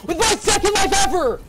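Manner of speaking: high-pitched, loud screams of disbelief; real hysterical victory shout; ecstatic screaming. Real, not acted. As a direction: scream it out, overwhelmed by the win. Real screaming after winning rare kill streak.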